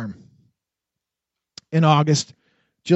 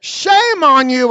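slow, higher and loud; vehement rebuke; 9 semitones higher, 6 dB louder, slower than usual. A narrator's reading. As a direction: spit it out with vehemence. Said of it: "I said again, surprised at my own vehemence".

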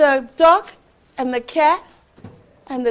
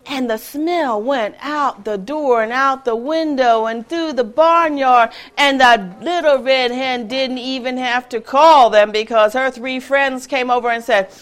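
ordinary delivery

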